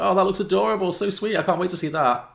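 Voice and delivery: high, loud, cooing excitement; imitated gushing housemate. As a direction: gush like someone charmed by cute clips. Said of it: "she was like".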